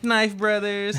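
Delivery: high, playful hype announcement; hyped announcer. Pretend. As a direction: announce it like a show title. Joking knife intro.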